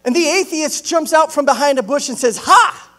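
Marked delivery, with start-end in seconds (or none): 2.4-2.9 loud, high gotcha cry; triumphant shout. Acted. Atheist jumping from behind a bush, triumphant shout. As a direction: burst out with a gloating shout.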